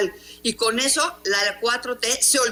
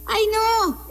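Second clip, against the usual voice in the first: much higher, squealed "ay, no" in mock alarm; retold shriek; 12 semitones higher than usual. Journalist replays Doris screeching at her arrival, high mock squeal. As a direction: imitate a scandalized squeal, pitched way up. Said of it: "me gritan".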